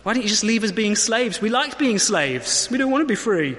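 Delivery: put-on grumpy teenager. Complaining people whining at Moses like teenagers. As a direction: sulk and whine at Moses. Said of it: "Sort of grumpy teenager mode".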